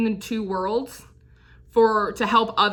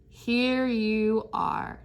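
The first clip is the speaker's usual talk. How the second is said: slow wondering reveal; 4 dB quieter, much slower than usual. Real, not acted. Slow revelation of card.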